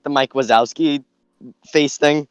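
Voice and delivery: ordinary talk, nothing marked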